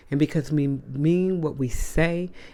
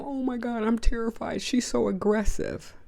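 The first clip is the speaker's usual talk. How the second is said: higher, mocking fake fright; mock terrified victim; 9 semitones higher than usual. Mocking the coworker playing victim, higher mock fright. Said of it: "Or you wanna play".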